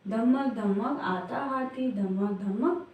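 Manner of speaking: put-on slow, rhythmic recitation of a children's poem; sing-song reading to a class of small children. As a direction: chant it in a steady beat, slowly. Teacher recites the elephant poem.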